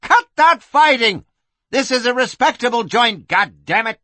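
high, loud madam's yell; angry shout. Acted. Madam yells at brawlers behind a door, angry shout. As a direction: yell it sharply through the door. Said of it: "threatened Kitty".

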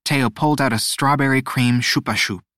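plain reading voice

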